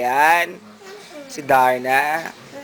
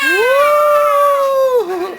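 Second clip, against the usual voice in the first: long, loud high whoop; excited whoop; 5 dB louder than usual. Real, not acted. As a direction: cheer with a piercing, drawn-out woo. Cheering the child's shout.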